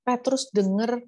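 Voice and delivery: ordinary delivery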